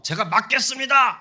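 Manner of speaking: high, loud defiant cry; Peter's shout. Retold or imitated. Peter crying out to stop Jesus's death, loud and high. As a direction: shout it like a fist-shaking vow. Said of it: "그러면서 외친 거거든요".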